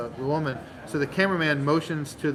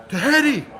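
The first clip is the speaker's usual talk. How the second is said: high, loud toast call; toast shout; 11 semitones higher, 8 dB louder than usual. Retold or imitated. Bartender toasting the son to the whole bar, loud and high. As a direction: raise a glass and shout it out. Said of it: "the bartender says".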